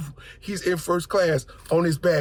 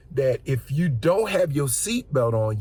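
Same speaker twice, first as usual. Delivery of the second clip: usual speaking voice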